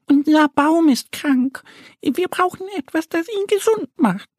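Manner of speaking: high little duck voice, a little timid; Annie a bit shy. Acted. Annie tells the stag about the sick tree, high and a little shy. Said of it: "sagt Annie ein wenig schüchtern".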